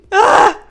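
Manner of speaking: loud, high mock-anguished "ah"; comic wail of exasperation; exasperated cry. Venting about being hard on herself.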